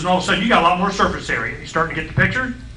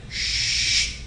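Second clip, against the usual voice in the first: gas hiss; 7 dB quieter than usual. Pretend. Imitates gas flowing in.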